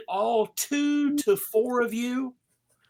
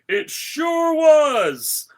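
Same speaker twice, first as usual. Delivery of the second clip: jolly Santa voice; 5 semitones higher, 5 dB louder than usual. Acted. Santa calling Frosty to come along, jolly and loud. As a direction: boom it out like a cheerful Santa. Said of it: "Santa goes".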